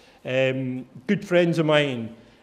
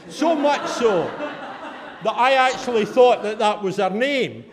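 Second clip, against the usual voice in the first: ordinary talk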